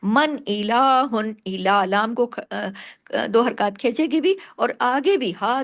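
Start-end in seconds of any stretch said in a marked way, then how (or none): 0.0-1.4 मन इलाहुन slow, नून said clearly with no nasal hum; slow recitation; clear izhar. Shown as a demonstration. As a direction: pronounce the नून cleanly, no ghunna. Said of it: "इधार हल्की होगा".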